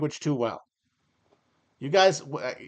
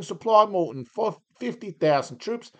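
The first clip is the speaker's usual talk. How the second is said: put-on Australian accent; 5 semitones higher than usual. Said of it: "that turned Australian there".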